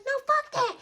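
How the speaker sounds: high, soft dismissive mom. Retold or imitated. Mom refusing daughter, high and dismissive. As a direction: brush it off as mom, pitched high. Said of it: "She's like".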